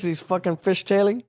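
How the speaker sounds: normal talking voice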